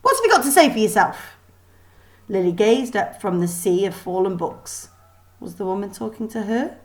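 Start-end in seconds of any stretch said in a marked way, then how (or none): 0.0-1.4 higher, louder, fast demand; angry teacher demanding an answer; stern demand. A narrator's reading. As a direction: press her for an answer.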